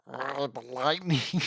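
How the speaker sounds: low, soft gurgle; gurgling voice. Shown as a demonstration. Reader trying out Zap's line, in a gurgling voice. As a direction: gurgle through the words, quietly. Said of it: "Zap in a gurgling voice".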